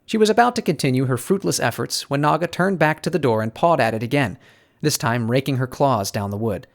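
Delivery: ordinary delivery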